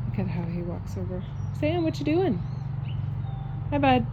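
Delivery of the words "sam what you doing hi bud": much higher, gentle call to the horse. Real, not acted. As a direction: sweetly coax the horse over. Calling to her horse.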